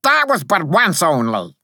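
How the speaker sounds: high little-soldier objection; high-pitched, earnest protest. A narrator's reading. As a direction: object indignantly in a piping voice. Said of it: "I protested".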